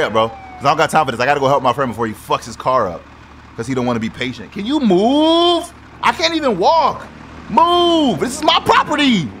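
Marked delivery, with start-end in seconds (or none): 4.5-9.3 high, slow exasperated demands; fed-up yelling; annoyed. Real, not acted. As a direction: shout them off your property. Yelling at player blocking.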